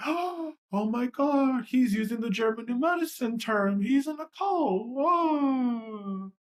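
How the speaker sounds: high-pitched hammed-up scandalized critic; mock horror. Mimicking critics shocked at German New Medicine, mock horror. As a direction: gasp it out high, mocking the pearl-clutchers.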